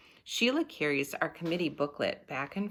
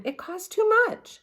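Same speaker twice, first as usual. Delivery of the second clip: put-on high, louder, know-it-all girl; bossy Sheila; 6 dB louder than usual. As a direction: lay down the law, high and loud. Sheila insists in story.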